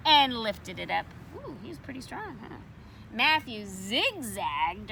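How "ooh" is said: usual speaking voice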